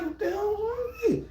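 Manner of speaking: very high, thin mock wail; nervous, imitated. Storyteller acting his reaction to Pac's look, nervous squeal. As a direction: squeak it out in a strained falsetto. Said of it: "I'm getting nervous".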